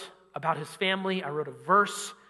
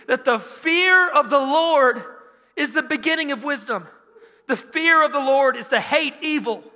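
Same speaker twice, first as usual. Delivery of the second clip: louder, higher fervent sermon voice; preaching; 6 semitones higher, 5 dB louder than usual. Acted. Preacher urging the congregation, loud and fervent. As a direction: preach it to the rafters.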